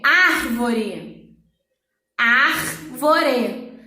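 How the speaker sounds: "Árvore" slowly, first syllable long with a throaty R; strong R demo. Shown as a demonstration. Teacher gives an example, "Árvore" with strong R. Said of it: "o R é forte mesmo estando sozinho".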